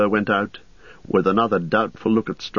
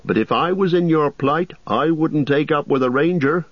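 higher, fussy innkeeper voice; innkeeper Butterbur; 9 semitones higher than usual. Acted. Landlord gossiping to Frodo about the ranger, raised fussy voice. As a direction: chatter like a nervous landlord.

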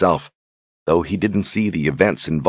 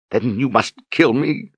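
crying out; 6 semitones higher than usual. A narrator's reading. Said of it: "she cried".

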